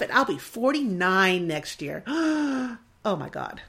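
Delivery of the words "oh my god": dismayed gasp. Real, not acted. Shocked at turning forty-nine.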